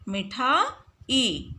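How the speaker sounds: मिठाई said very slowly, ending on a long ई; slow spelled-out word. Shown as a demonstration. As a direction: stretch मिठाई out for the class. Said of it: "शुद्ध". (मिठाई बहुत धीरे, लंबी ई के साथ)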